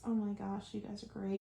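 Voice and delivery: ordinary delivery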